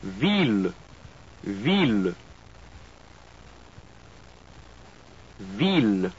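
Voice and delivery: "Ville" as veel, not vill; French pronunciation. Shown as a demonstration. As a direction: model "Ville" with a pure French i. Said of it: "followed by the French word twice".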